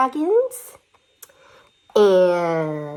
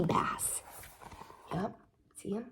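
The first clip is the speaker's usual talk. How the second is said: whisper; 15 dB quieter than usual. Acted. Whispered joke.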